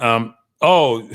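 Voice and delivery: ordinary talk, nothing marked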